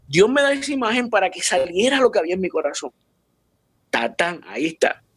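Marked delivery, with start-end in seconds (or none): none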